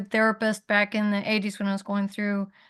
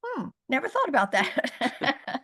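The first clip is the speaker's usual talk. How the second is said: amused realization; 6 semitones higher than usual. Retold or imitated. Realizing the therapist's point.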